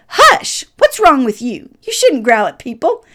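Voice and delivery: sharp high "Hush!" then firm scolding; stern scolding. Retold or imitated. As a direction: scold the growling dog firmly. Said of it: "I was stern".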